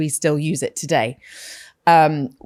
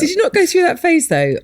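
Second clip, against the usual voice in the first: much higher, louder laughing ask; laughing question; 11 semitones higher, 5 dB louder than usual. Real, not acted. Cohost asks her friend, laughing.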